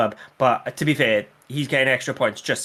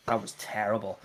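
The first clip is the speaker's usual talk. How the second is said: normal talking voice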